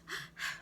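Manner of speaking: quick panicked gasp, demo of shallow breath; snatch a sharp breath in; short gasp for air. Shown as a demonstration. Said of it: "talking like this?".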